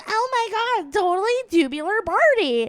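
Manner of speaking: put-on high, gushing Valley Girl accent; teen Valley Girl squeal. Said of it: "fifteen going".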